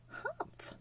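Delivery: very high tiny humph; baby kangaroo humph. Retold or imitated. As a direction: squeak it like a little joey. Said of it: "the young kangaroo in her pouch said".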